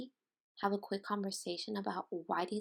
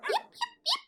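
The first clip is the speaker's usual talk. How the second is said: very high yapping puppy; puppy yips; 4 dB louder than usual. Acted. Little puppy barking in the story, very high yaps. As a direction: yap like a tiny dog.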